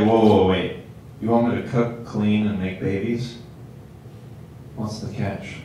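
low, soft, wary guy; suspicious. Retold or imitated. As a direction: question the deal as if smelling a scam. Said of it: "they would think it's a scam".

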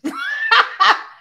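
high shrieking laugh; loud burst of laughter. Real, not acted. Cracking up at own joke.

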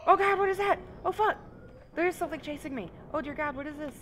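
panicked high shouts; terrified screaming. Real, not acted. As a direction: scream in real terror. Chased by a monster.

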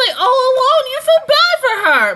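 loud, high-pitched wail of sympathy; exaggerated pity. Real, not acted. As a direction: wail it with over-the-top sympathy. Playing up pity for the heroine.

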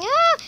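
high loud shriek; panicked scream. Real, not acted. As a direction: shriek in fright. Chased by player.

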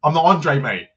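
loud mock Cockney snap, then a dropped "mate"; Cockney accent. Footballer denying his name in a put-on Cockney accent. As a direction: toss it off like a Londoner brushing off a journalist. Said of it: "dropped into this Cockney accent".

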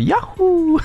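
high-pitched cartoon whoop; Mario. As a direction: re-enact a high game-hero cheer. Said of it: "I had to yell out".

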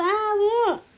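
a short crying moan; tearful whimper.

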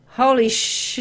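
jolted, high exclamation; startled. Real, not acted. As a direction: caught off guard, blurting. Startled after scary scene.

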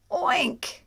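high, loud piggy oink. Acted. Reading to a child, pig sound oink. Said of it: "which is what pigs say".

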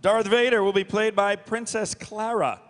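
usual speaking voice

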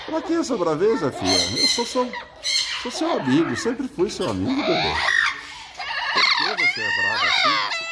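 high coaxing pet voice. Real, not acted. Coaxing the parrot as a friend. (falando manhoso com a ave, voz aguda)

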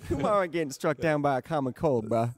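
high put-on Indian accent; a bad Indian accent, hammed up. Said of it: "Needs to be like a bad Indian accent".

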